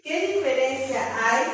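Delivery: normal talking voice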